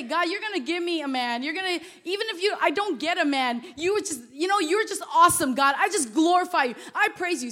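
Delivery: acted-out impassioned prayer; fervent praise. Acting out own fervent prayer.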